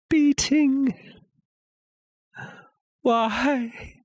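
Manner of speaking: high, anguished questioning; begging the sound to stop; desperate, high-pitched dread; tormented pleading. Acted. Tormented by the heartbeat.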